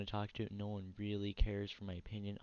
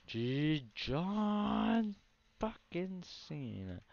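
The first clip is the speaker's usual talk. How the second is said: John Cena announcer call; 9 semitones higher, 3 dB louder, much slower than usual. Pretend. Announcer-style hype shout.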